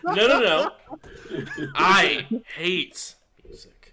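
shouting, slow and high; loud Hardwire voice. Acted. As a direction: yell it out slow. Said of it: "we've already done Hardwire".

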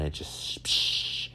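soft breathy psh of ice hitting the floor; bag of ice drop. Retold or imitated. His knees popping like dropped ice, a soft breathy psh. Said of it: "when you drop it on the ground".

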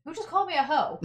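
loud, high indignant yell; playful fake outrage at the table; loud mock outrage. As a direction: bellow it, pretending offence. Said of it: "You just hear me say that really loud".

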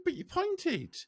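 indignant protest. Retold or imitated. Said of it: "I said".

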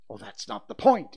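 loud, impatient retort; impatient father answering back. A narrator's reading. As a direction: brush the objection aside loudly. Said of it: "he sh-, retorted".

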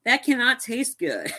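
high, slow, mock-appalled verdict; dubious distaste. Host judging the colored milk drink, appalled. Retold disgusted reaction to milk.

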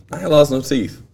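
normal talking voice

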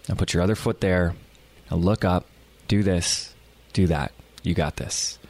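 low, calm guidance in short spaced phrases; reassuring coach. Retold or imitated. Coach talking a frustrated kite student through it, calm and reassuring. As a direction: settle them down step by step, voice dropping low. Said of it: "we need a good coach".

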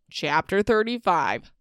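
normal talking voice

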